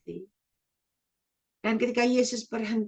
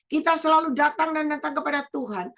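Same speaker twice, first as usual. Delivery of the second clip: ordinary talk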